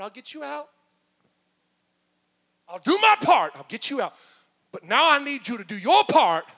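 from firm to loud commanding shout; God's promise grows into a shouted order; building to a shout. Acted.

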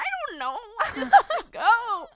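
laughing through the words; high, loud, laughing and squealing. Retold or imitated. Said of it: "I was like".

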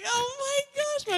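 high, slow drawn-out gasp of delight; excited gasp. Retold or imitated. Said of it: "And I was like".

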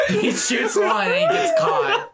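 high laughing squeal. Real, not acted. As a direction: laugh through the words. Laughing through the story.

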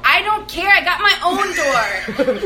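imitated defiant child. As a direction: act out a stubborn kid refusing help. Voices defiant young daughter arguing back.

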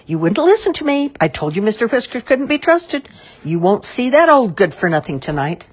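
loud, high-pitched scold. A narrator's reading. Mister Grape scolds the mayor and the crowd, loudly. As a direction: berate them at high pitch and volume. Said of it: "While Mister Grape scolded the mayor".

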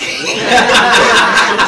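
big burst of laughter; loud embarrassed laugh. Real, not acted. Caught forgetting her mom on air, laughing loudly.

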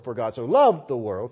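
"loved" punched loud, the other words lighter; heavy emphasis on "loved" alone; stress on loved. Shown as a demonstration. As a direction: lean hard on the word "loved". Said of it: "I'll read it like this".

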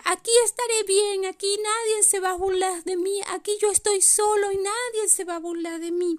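vocecita aguda de renito; pequeño reno solitario. Acted. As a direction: habla como un reno chiquito, en tono alto. (renito solo y resentido)

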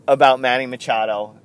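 normal talking voice

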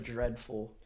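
low, quiet British dismissal; understated British critic's verdict; soft, low put-on British accent; Simon Cowell. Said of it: "He'll say, like".

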